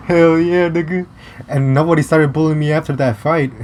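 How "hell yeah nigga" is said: gloating cheer. Real, not acted. Gloating over the bully's fate.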